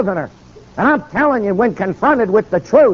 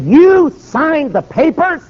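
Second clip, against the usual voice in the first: high, slow, louder disbelief on YOU; incredulous challenge; 11 semitones higher, 4 dB louder, much slower than usual. Retold or imitated. Preacher confronting governor who approved the papers, incredulous. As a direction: stretch it out as a shocked accusation.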